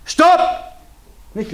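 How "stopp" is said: sharp shouted Stopp; loud stop. Shown as a demonstration. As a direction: bark it out loud. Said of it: "Sehr wichtig ist immer das lautes Stopp".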